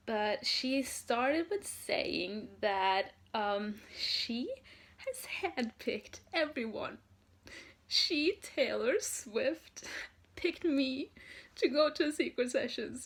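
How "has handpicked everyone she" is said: ordinary talk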